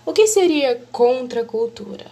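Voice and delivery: usual speaking voice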